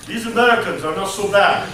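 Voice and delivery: playful put-on Russian accent. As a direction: voice a Soviet citizen with a Russian accent. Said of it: "I do my Russian accent".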